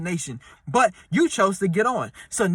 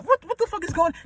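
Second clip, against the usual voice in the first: high mock freak-out; panicked confusion; 11 semitones higher, faster than usual. Sinking passenger repeating the question, high mock panic. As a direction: stammer it out in a panic.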